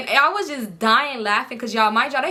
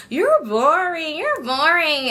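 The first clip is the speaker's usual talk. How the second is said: slow, higher-pitched mocking complaint; mock complaining; 6 semitones higher, much slower than usual. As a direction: draw it out like a bored friend nagging. Friend's complaint acted out, drawn out.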